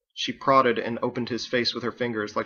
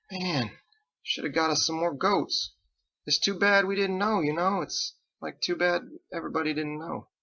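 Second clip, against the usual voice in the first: lightly voiced dopey sailor; 6 semitones higher than usual. Ditch whining dejectedly.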